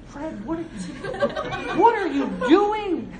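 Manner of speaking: high, laughing "what are you doing?"; startled laughing cry. Retold or imitated. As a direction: shriek at Fred through a laugh as plaster falls. Startled host under the roof.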